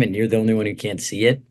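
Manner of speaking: ordinary talk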